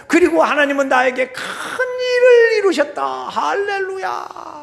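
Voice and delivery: high, loud, drawn-out proclamation; stretched, high-pitched hallelujah preaching; full-force preaching. Acted. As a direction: proclaim it slowly to the whole church, ending on a long cry.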